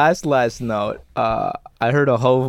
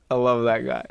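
usual speaking voice

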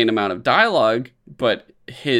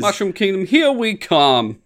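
Mario; 8 semitones higher, 4 dB louder than usual. Retold or imitated. Cohost mimicking the trailer line, hammed-up catchphrase. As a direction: stretch out "here we come" high and loud. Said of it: "like, he says".

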